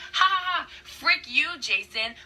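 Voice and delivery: triumphant evil laugh and jeer; villain cackle. Retold or imitated. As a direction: cackle down at him from the chariot. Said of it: "she's like".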